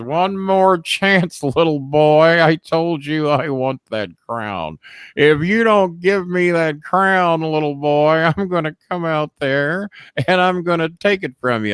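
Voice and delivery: slow heel ultimatum; final threat. Retold or imitated. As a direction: threaten slowly, rising.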